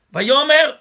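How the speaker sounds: Vayomer said loud and high, called out; aloud. Shown as a demonstration. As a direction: announce Vayomer full voice. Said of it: "à haute voix".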